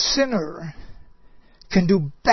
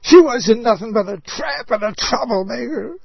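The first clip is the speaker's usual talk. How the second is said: raised, incredulous yell; 4 semitones higher, 4 dB louder than usual. Retold or imitated. Preacher yells at false comfort at funeral, incredulous. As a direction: burst out in disbelief. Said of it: "I just want to yell".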